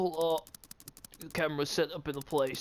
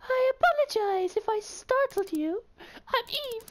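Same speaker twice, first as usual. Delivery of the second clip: very high falsetto woman; man doing a woman's voice; 3 dB louder than usual. Pretend. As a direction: squeak it out as a lady. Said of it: "I need to put girl voice on".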